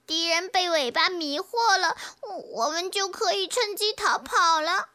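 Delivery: high, tearful creature voice, rushing at end; sniffling. Acted. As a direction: explain through sniffles, then hurry upset. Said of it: "小壁虎吸吸鼻子说". (吸着鼻子哭诉)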